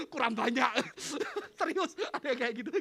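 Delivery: high, loud laughing speech; real laughing. Real, not acted. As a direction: talk through helpless laughter. Laughing at his own joke.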